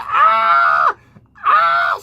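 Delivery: very high, loud mock wail; hammed-up shriek like a wounded antelope; wounded-animal scream. Teacher thrown on the floor, mock wounded screaming. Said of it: "she sounds like a fucking wounded antelope".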